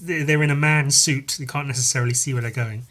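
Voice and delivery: ordinary talk, nothing marked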